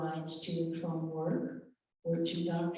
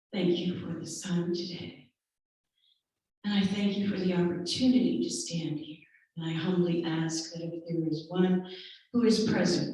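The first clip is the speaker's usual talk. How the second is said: earnest, louder spoken prayer; 5 dB louder than usual. Acted. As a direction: pray over the people with conviction. Prays aloud for the congregation.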